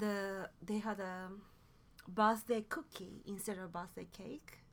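ordinary talk, nothing marked

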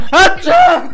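high, loud pretend sneeze; fake sneeze. Joking his body rejects Dota one, hammed-up sneeze.

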